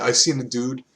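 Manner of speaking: ordinary talk, nothing marked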